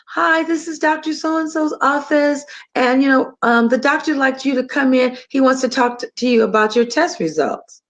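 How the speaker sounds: imitating a routine call from the clinic; generic office caller. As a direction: put on an impersonal front-desk phone manner. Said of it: "the call is very generic".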